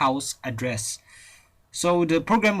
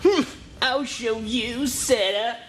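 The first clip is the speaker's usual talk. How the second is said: put-on high, slow scheming boy; villain voice; 7 semitones higher, slower than usual. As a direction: sneer it like a little villain. Naughty Bob vows revenge.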